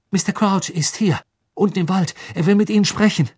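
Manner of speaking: high, pressing, excited delivery; urgent report. Acted. Harry telling Dumbledore about Crouch, urgent and high. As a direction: blurt it out before being interrupted.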